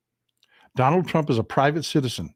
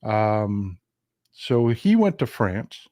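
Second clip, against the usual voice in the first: ordinary talk, nothing marked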